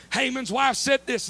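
usual speaking voice

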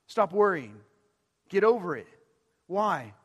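fiery pulpit appeal; fervent preaching. Acted. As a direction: press the point home like a preacher. Preaching at full force.

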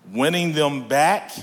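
usual speaking voice

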